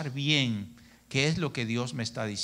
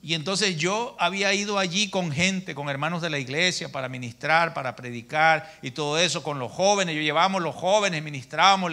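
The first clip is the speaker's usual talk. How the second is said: ordinary talk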